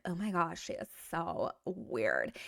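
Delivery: mock gossip. Acted gossip reply.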